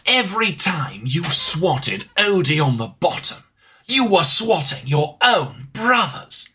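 slow, grandiose revelation; mock-epic game villain; hammed-up villain monologue. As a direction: declaim it with swooping emphasis.

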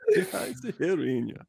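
high-pitched mock French accent; French accent in German. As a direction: speak German as a hammed-up Frenchman. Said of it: "French accent in German, for example".

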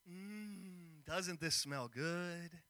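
low, slow, quiet taunting croon; smug kid brother gloating over his stew; teasing little brother. Retold or imitated. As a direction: dangle the stew in front of him, savoring it. Brother teasing hungry Esau.

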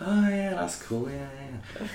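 awkward polite reply. Retold or imitated. Nervous polite reply retold.

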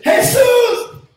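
high loud shout of a name; calling out. Acted. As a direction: call across the street. Blind men calling Jesus again.

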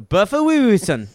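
loud, high cartoonish Japanese accent; hammed-up Japanese shout; mock Japanese accent. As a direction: burst out in an exaggerated fake Japanese accent. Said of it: "Or in Japanese".

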